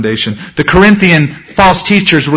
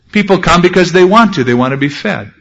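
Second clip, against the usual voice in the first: ordinary delivery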